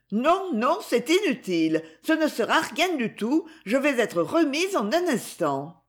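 put-on the young lady insists she's fine; young woman protesting. A narrator's reading.

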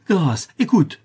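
loud, high plea; begging for mercy. A narrator's reading. Notary begging as Cécilie closes the grille, imploring. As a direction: implore urgently.

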